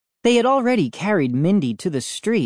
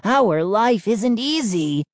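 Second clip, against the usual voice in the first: slow, higher plea with light rasp; raspy gnome; 7 semitones higher than usual. A narrator's reading. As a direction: plead slowly as a pouty gnome. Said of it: "Chip rasped".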